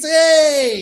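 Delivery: a high, loud hey; excited hey. Real, not acted. Greeting a chatter in the quiz chat, excited high hey.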